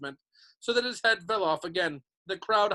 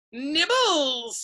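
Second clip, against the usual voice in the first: high, loud sing-out offer; mock sweet; 10 semitones higher, 6 dB louder than usual. A narrator's reading. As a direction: sing it out sweetly to tempt them. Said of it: "he said sweetly".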